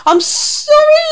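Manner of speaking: very high, loud panicked squeal; spluttering, shaking dragon. A narrator's reading. Dragon apologises to the beast, spluttering in fright. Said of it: "he spluttered".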